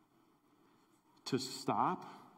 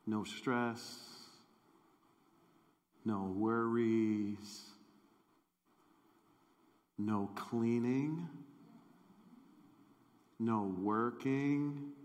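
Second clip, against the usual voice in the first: slow dreamy list; much slower than usual. Acted.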